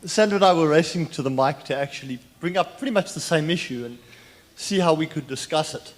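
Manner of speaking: winded, loud and rushed; out of breath. Real, not acted. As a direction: talk while catching your breath. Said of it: "sorry, I'm a little bit winded".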